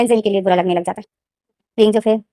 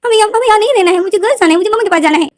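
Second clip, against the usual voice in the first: put-on high, loud whiny child begging; a little boy wailing to go home to mom; child pleading; 11 semitones higher, 5 dB louder than usual. Little brother begging to go home, high whiny plea.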